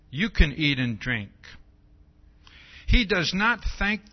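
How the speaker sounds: loud, preaching read of the verse; preacher proclaiming scripture loudly; scripture reading at full force. Acted. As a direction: drive the verse home to the room. Reads scripture aloud.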